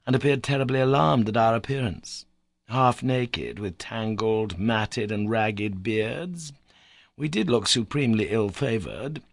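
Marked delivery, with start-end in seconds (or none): none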